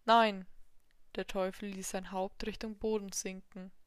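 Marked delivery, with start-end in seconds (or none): 0.0-0.5 curt refusal. Acted. Devil refuses demon.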